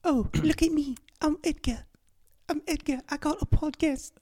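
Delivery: very high, squeaky British accent; hammed-up British show-off; mock British accent. Shown as a demonstration. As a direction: do a silly falsetto British accent. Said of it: "Let me hear it then".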